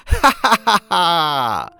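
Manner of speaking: long, slow joyful belly laugh; rolling delighted laughter; hearty laugh. A narrator's reading. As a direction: laugh like you haven't in years. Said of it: "他笑得十分欢畅".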